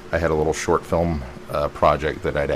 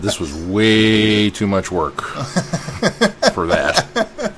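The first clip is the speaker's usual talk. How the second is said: laughing; 4 semitones higher, 3 dB louder, much slower than usual. Retold or imitated. Retold line dissolving into laughter.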